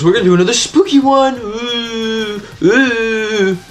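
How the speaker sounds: high, playful mock-spooky voice; hammed-up spooky announcement; Kane. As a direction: finish on drawn-out ghostly oohs. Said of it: "Kane goes".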